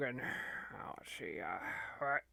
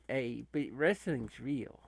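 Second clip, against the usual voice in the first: ordinary delivery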